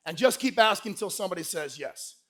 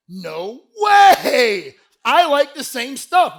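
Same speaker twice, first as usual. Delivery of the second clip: mock-amazed shout, vowel stretched out; hammed-up fake excitement; overeager disbelief; 5 semitones higher, 12 dB louder, much slower than usual. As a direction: blurt it high and loud like a thrilled suitor. [name] overeager fake agreement.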